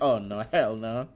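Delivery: mock dread. Adventurers seeing a roomful of goblins, low mock dread. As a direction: voice a dismayed adventurer, understated.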